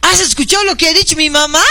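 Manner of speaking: high, fast, mock-alarmed daughter; a hint of the startled daughter, quick and high; daughter's alarmed question. Daughter asking her mother what grandma said, high and quick. Daughter voiced in retold argument.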